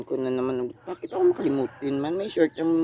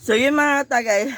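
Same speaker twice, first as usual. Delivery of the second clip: excited; 8 semitones higher, 6 dB louder than usual. Real, not acted. Excited about spicy food.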